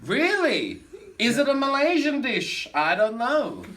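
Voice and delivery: high, loud comic incredulity; mock astonishment. Showman questioning guest about pavlova, mock astonished. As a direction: react with exaggerated, high-pitched surprise.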